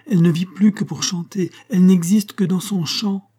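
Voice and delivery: impassioned despair. A narrator's reading. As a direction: plead it passionately, voice lifted. Maître désespéré plaide avec passion. (désespoir passionné, voix plus haute)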